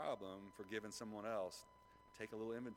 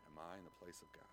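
soft repeated question; 9 dB quieter than usual. Shown as a demonstration. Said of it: "Think about that for a second".

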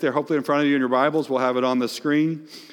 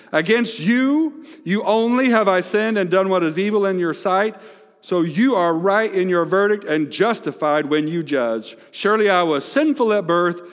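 raised, fervent scripture reading; preaching the psalm; 5 semitones higher, 3 dB louder than usual. Acted. Preacher reading David's psalm, fervent and raised. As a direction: proclaim David's plea with force.

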